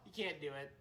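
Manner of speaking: normal talking voice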